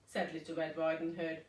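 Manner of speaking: ordinary delivery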